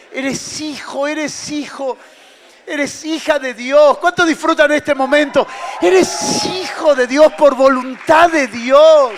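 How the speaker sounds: loud, high preaching shout; preaching at full force. Acted. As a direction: cry it out over the congregation, slowing on key words.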